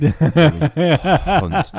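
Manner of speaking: name drawn out slowly through a laugh. Retold or imitated. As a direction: stretch Hunt out while cracking up. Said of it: "when people run through".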